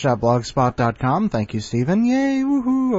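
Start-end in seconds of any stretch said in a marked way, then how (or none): 2.0-3.0 excited cheer. Real, not acted. Host cheering after the story.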